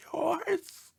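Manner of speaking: high whispered closing; whispered sign-off. Acted. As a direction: whisper the closing word, rising. Dog signs off letter.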